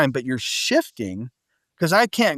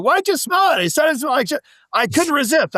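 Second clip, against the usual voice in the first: high-pitched comic protest; incredulous mock exchange; 12 semitones higher than usual. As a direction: act out a silly squabble, pitched way up. Acted exchange about sniffing something foul.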